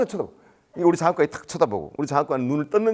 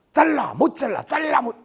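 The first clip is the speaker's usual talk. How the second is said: high-pitched parroting of a shouting match; mocking mimicry; 11 semitones higher than usual. Boss mocking rookie's phone row, high mimicry.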